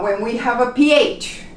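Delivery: ordinary talk, nothing marked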